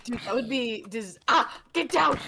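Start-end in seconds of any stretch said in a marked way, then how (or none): 1.3-2.2 loud, higher yell; sudden loud alarm; shouted warning. Real, not acted. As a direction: yell for them to duck.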